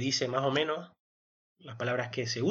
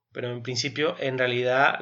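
normal talking voice

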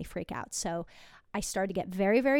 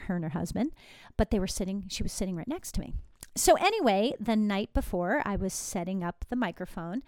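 ordinary delivery

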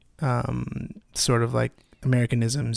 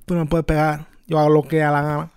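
loud, higher Puerto Rican street slang; Puerto Rican accent; 4 semitones higher, 4 dB louder than usual. Pretend. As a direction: Puerto Rican accent, sounding vaguely Russian. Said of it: "you sound Russian".

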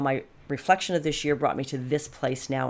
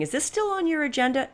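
high, chirpy sing-song sales rep; teasing imitation of a cheery sales pitch; mock sales rep; 11 semitones higher than usual. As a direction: lightly mimic a pushy salesperson asking again. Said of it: "emailing me saying".

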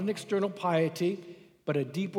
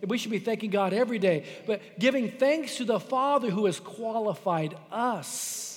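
ordinary delivery

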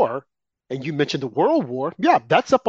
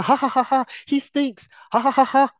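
higher mocking ha-ha-ha; taunting fake laugh, higher; mock laughter; 9 semitones higher than usual. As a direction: imitate talk shows jeering. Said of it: "They wanna".

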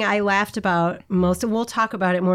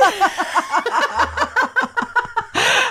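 high burst of laughter; 12 semitones higher than usual. Real, not acted. Laughing at the story.

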